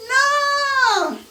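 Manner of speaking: a squealing emphatic no; shrieked no. Real, not acted. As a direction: cry out no in mock horror. Emphatic refusal.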